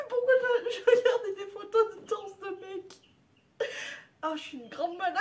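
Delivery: high, tearful laughing words; wheezing laughter through a question; crying with laughter. Real, not acted. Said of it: "j'en pleure de rire".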